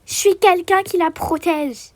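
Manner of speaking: ordinary talk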